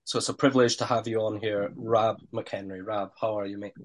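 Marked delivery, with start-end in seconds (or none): none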